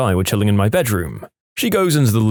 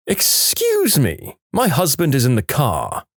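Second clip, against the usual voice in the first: much higher, slow, drawn-out "Excuse me" in a put-on voice; entitled harpy lady; 4 semitones higher, slower than usual. Rude driver threatening with her husband, haughty and high. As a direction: play a haughty woman making a threat. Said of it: "some harpy lady".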